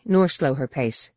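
ordinary delivery, nothing marked

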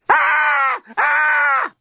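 high-pitched drawn-out scream. A narrator's reading. Captive woman decides to scream, high terrified screams. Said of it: "Screaming really seemed the only sensible alternative".

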